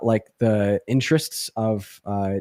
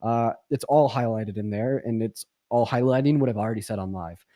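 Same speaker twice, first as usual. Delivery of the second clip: ordinary delivery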